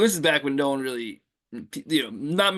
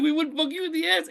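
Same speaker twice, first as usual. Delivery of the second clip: mock protesting denial; 12 semitones higher than usual. Voices the group's denial.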